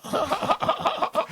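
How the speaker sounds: high-pitched laughter; laughing. Real, not acted. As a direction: crack up at your own joke. Speaker laughs at own accent joke.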